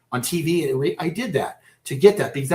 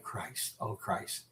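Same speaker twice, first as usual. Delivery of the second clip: dismayed whisper; 6 semitones lower, 16 dB quieter, much slower than usual. Retold or imitated. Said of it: "He went".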